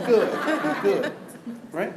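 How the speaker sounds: chuckling through the words; a real chuckle while saying it; laughing reassurance. Real, not acted. Reassuring audience after joke.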